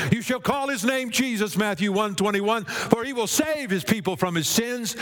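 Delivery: high-pitched, ringing sermon delivery; rousing preacher's cadence; impassioned preaching. Real, not acted. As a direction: proclaim it to the whole congregation.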